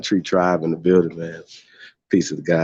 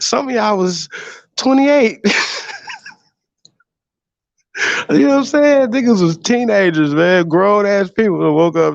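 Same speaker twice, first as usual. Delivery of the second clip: higher, giggly voice cracking up; laughing while talking; 13 semitones higher than usual. Real, not acted. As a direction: talk through real laughter, pitched up. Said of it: "I'm, I'm laughing".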